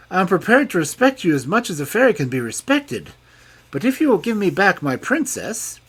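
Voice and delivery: pleading king. A narrator's reading. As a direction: bargain with the fairy. King answers the fairy.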